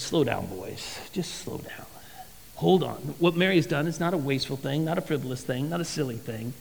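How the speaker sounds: soft, low, reassuring hush; calming. Retold or imitated. Jesus calming the upset disciples, soft and reassuring. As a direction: settle the angry boys down gently.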